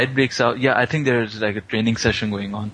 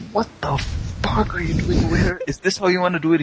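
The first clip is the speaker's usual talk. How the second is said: mock pissed off; 8 semitones higher than usual. Girl finds him at her dorm door, mock outrage. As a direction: act out indignant disbelief, pitched up. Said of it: "she's pissed off".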